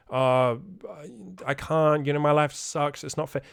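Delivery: put-on helpless moaning; mock complaining. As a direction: imitate someone feeling sorry for themselves. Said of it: "get all the complaining out".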